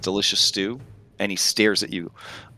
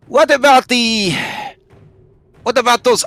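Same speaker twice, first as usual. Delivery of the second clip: high, loud call across a distance; raised, high-pitched shouted question; yelling to another; 13 semitones higher, 5 dB louder than usual. Acted. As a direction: yell it out in a high voice. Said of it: "And then they yell, yell to the other one".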